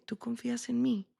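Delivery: soft "¿Tú confías en mí?", almost hushed; gentle question. Retold or imitated. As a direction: ask "¿Tú confías en mí?" quietly, like a calm mother. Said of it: "le hice una pregunta y le dije".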